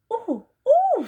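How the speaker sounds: high, loud surprised cries; startled ooh. Acted. Susan suddenly finds something strange, startled cries. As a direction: yelp in sudden alarm.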